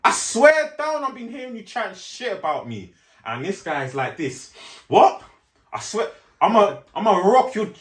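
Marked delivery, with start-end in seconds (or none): 0.0-2.9 aggressive shout. Retold or imitated. Confronting a mate about gossip, shouting angrily. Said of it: "chat to that person on the madness". 4.9-7.7 much higher and louder, furious comeback; angry threat. Retold or imitated. Accused guy threatening back, loud furious threat.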